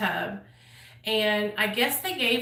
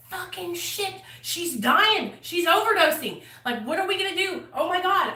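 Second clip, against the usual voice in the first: panicked; 7 semitones higher than usual. Retold or imitated. Panics as friend overdoses.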